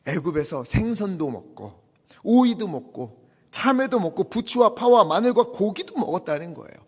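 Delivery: ordinary talk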